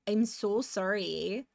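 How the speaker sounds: slow, drawn-out Canadian "sorry"; lightly put-on Canadian accent on "I'm so sorry". Shown as a demonstration. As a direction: stretch "sorry" the Canadian way, round and apologetic. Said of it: "that's a very Canadian sorry".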